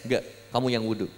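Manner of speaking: low, soft, quick comeback; restrained retort. Retold or imitated. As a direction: refuse under your breath, fast. Retold quarrel, first quiet retort. (bantahan pelan dan cepat)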